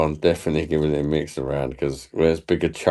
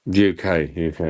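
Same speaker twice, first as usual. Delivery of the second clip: ordinary talk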